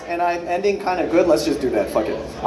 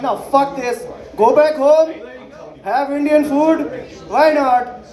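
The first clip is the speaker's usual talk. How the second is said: eager homesick relatives; 5 semitones higher, slower than usual. Retold or imitated. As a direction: play the in-laws longing for Indian food, slow and high. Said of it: "they're like".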